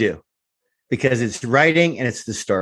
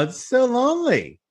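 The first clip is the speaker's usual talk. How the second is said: mock sympathy; 11 semitones higher than usual. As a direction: pretend to feel sorry. Mock sympathy for van scene.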